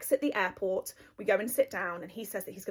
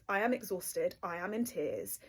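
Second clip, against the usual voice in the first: ordinary talk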